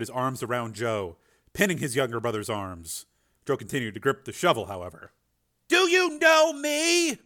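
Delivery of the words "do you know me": high, slow, pleading shout; urgent yell. A narrator's reading. Frank in his brother's ear, urgent yell. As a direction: yell it deliberately, word by word. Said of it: "Frank yelled in his brother's ear".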